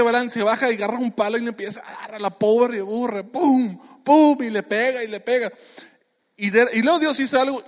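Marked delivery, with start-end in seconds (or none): 3.2-4.4 acted stick hits, rising to a high loud Pum; playful sound effect of a beating; mock blows. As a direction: punch out each Pum like a strike.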